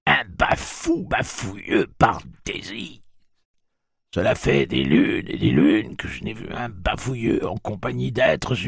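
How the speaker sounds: an ancient aunt recalling her birthday; very old woman. A narrator's reading. Old Teresa about the billy-bumbler.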